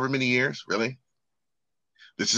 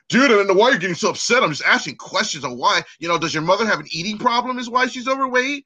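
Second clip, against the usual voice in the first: mock innocent questioner; 8 semitones higher than usual. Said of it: "where it's like".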